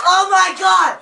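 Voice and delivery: high, laughing cry of pain; laughing and yelling at once; laughing shout. Real, not acted. After burning drink.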